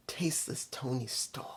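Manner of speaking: hushed whisper; whispered aside. Real, not acted. Whispering a jab at Toni Storm, hushed.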